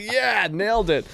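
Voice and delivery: high, loud, slow victory cheer; gloating after hanging up the phone; triumphant. Retold or imitated. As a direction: celebrate like you scored. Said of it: "I was like".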